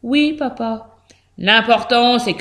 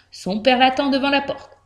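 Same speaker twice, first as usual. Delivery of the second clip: usual speaking voice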